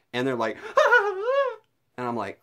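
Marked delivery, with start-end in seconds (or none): 0.6-1.6 much higher, loud startled giggle; high shocked giggle; squealing laugh. Retold or imitated. Employee's startled reaction.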